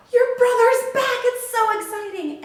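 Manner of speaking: excited servant. Acted. A servant telling the older brother, thrilled. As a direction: blurt out the good news.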